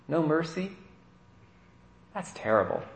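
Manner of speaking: normal talking voice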